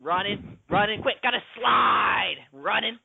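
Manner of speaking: high-pitched, urgent play-acting; hammed-up panic, stretching the big move; mock-frantic action. Critic acting out a running movie, mock-frantic and high.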